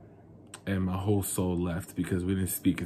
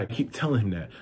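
ordinary talk

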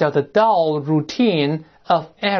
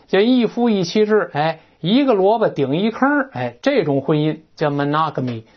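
usual speaking voice

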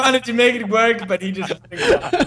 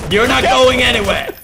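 loud, higher-pitched threat; playful threat; 4 semitones higher, 6 dB louder than usual. Pretend. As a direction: call it out loudly to stop the runaway. Cornering a hider in game.

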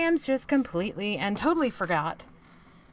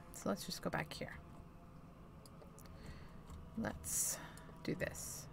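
whispering; 16 dB quieter than usual. Real, not acted. Quiet whispered resolve.

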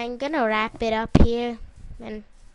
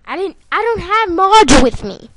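loud protest; 6 semitones higher, 14 dB louder than usual. Real, not acted. Protests being called a hacker.

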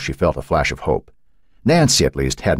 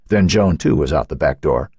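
ordinary delivery, nothing marked

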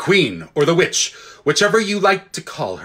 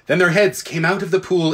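plain reading voice

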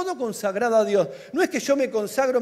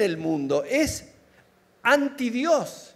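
slow emphatic; much slower than usual. Acted. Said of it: "sermon emphasis".

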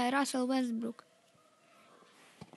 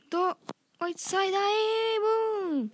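hyped boom; 6 semitones higher, 3 dB louder, slower than usual. Real, not acted. Big dunk celebration.

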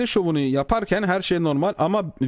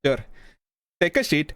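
playful mock Indian English accent; English in a hammed-up Indian accent; 4 semitones lower than usual. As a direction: put on a comic Indian accent. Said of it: "Hint aksanıyla konuşsan abi".

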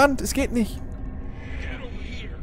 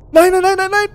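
being chased; 14 semitones higher, 7 dB louder than usual. Real, not acted. Killer right behind him.

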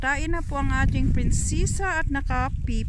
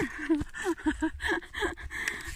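long soft giggle; quiet tittering laugh; amused laugh; 3 semitones higher, 6 dB quieter than usual. Real, not acted. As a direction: giggle away after the chase. Laughing after dog runs.

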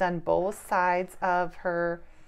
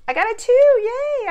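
much higher and louder, a delighted yay; excited cheer; 13 dB louder than usual. Acted. Parent cheering her roll to her child, excited cheer. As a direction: cheer like a kid who just won.

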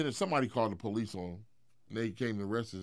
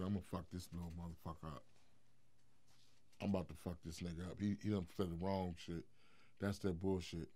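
muttering; 5 semitones lower, 12 dB quieter, faster than usual. Retold or imitated. Angry thoughts told in story.

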